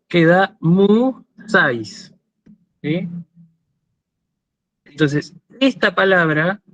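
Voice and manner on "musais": "Musais" said slowly, syllable by syllable: Mu-sa-is; split into syllables. Shown as a demonstration. Teacher dividing "Musais" into syllables for students, slow and deliberate. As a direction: spell out "Musais" slowly, one syllable at a time for the class. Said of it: "yo separo en sílabas".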